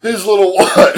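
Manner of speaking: laughing tease. Real, not acted. Teasing loud question.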